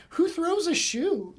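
high, loud, slow indignant movie quote; hammed-up outraged shriek from the film; movie line impression. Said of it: "that line in, uh, Austin Powers".

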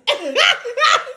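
shrill giggle; high squealing laugh. Real, not acted. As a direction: burst into a squeal. Laughing after anchor voice.

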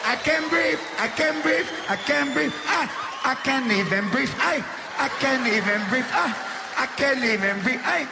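high whiny toddler wheeze, mocking; little kids copying the gasping uncle.

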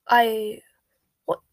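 startled, broken-up splutter; mildly aghast, halting between words. A narrator's reading. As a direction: stammer in disbelief, word by halting word. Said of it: "spluttered the Prime Minister".

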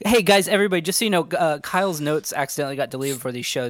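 calling out loudly to the whole room; loud announcement. Real, not acted. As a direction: grab everyone's attention with a loud call. Announcing to the audience.

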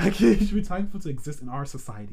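fast read, laughing; laughing through it. Retold or imitated. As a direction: rush through the quote with a laugh. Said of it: "I can't do the accent".

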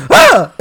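high, loud startled yelp; mock scream of fright. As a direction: jump at the pyro with a shriek. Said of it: "Ultimo Dragon goes".

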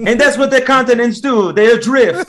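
high, loud mock Italian accent. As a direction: proclaim it like a hammed-up Italian. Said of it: "the Italian accent is approaching".

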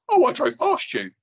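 light sing-song imitation of a passer-by; high, drawn-out casual remark; casual mock bystander. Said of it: "I've had four people tell me that".

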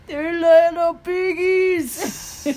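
loud, high, drawn-out cooing; cutesy squeal. Pretend. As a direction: squeal slowly over something adorable, playfully. Cooing over husband's toes.

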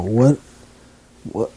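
ordinary talk

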